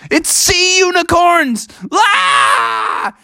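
high-pitched, drawn-out cry of discovery; acted squeal of wonder and alarm; astonished shriek. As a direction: shriek it in mock amazement. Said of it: "and he's like".

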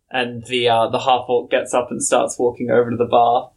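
ordinary talk, nothing marked

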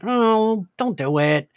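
usual speaking voice

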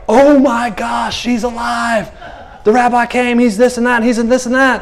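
a stunned crowd shouting the news; amazed crowd. Retold or imitated. Crowd amazed girl alive.

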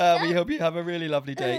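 laughing. Real, not acted. After laughing fit.

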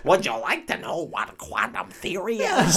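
hammed-up bad American accent; Sophie Turner. Podcaster doing Jean Grey to Professor X, bad American accent. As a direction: put on a goofy, badly faked American accent. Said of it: "I've sort of gone with that".

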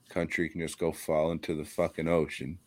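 ordinary talk, nothing marked

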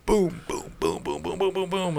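soft, quick booms; man imitating hail pounding outside, soft booms; hail imitation. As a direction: tap out the hail hitting.

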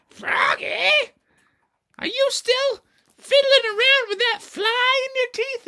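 very high, loud screechy scold; scolding teacher. Acted. Teacher catches Froggy fiddling with his teeth, shrill scolding. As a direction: shriek it like an indignant teacher.

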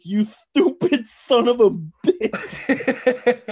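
high, loud, drawn-out mock insult; imitating punched movie villain. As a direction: drag out a hammed-up curse, about to crack up laughing. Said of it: "he punches him again, and he goes".

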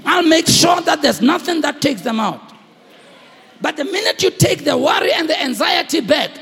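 loud, high preacher cry; preaching at full force. Acted. Pastor assuring believers God guards them, loud preaching.

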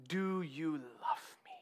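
slow, whispered asking; tender question. Retold or imitated. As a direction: ask softly, as an imitated intimate plea. Jesus tenderly questions Peter.

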